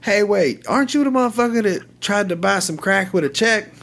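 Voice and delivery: high, put-on voice of a wiry little crackhead; imitating a squeaky crackhead, amused; voiced crackhead. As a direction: mimic a high-pitched jittery crackhead recognizing someone. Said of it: "And he goes".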